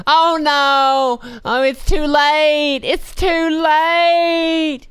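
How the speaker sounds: high, slow mock wail of the old lady. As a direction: drag out a high despairing wail. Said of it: "Now she goes".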